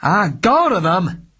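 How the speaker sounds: detective furious at his client; loud with rage. Acted. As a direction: explode in anger. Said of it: "his voice loud with rage".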